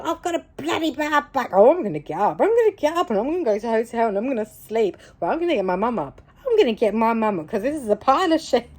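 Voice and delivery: high, fast mock grumble; whinging inner voice. Battling her own brain during the meditation, whinging. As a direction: rattle off a tantrum, half laughing. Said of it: "having this battle in my brain going".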